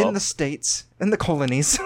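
jokey British aside; mock British accent. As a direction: sniff at the colonies in a hammy British voice. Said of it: "Al loves my British accents".

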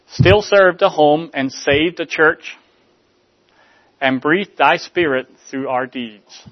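slow, solemn recitation of a hymn; recited hymn verse. Acted. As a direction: lift the words like a hymn.

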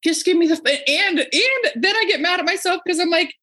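high, worked-up rant; aggravated rant. Real, not acted. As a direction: vent the frustration. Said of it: "I'm getting really fucking aggravated".

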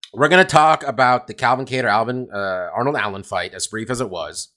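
ordinary talk, nothing marked

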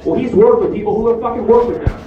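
ordinary talk, nothing marked

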